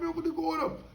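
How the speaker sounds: high-pitched bewildered outburst; exasperated. Retold or imitated. Man wondering where his cat went, high exasperated. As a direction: throw up your hands in confusion. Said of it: "I'm like".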